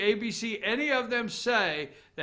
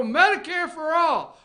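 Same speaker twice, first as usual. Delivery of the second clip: mocking slogan; 6 semitones higher, 6 dB louder than usual. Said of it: "they want to take your health care and go".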